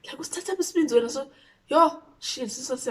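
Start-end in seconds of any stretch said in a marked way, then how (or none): 0.2-1.1 high, mock-furious Siswati scolding; angry mom in Siswati. Mother scolding the friend in Siswati, hammed-up. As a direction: snap it like a raging mother. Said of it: "She said it in Siswati".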